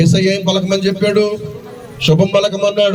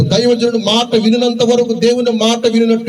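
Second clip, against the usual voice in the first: raised, slow preaching cadence with drawn-out phrases; preaching at full force; 4 semitones higher, slower than usual. Acted. Preacher to his congregation, lifted preaching voice. Preacher voicing God, then scripture.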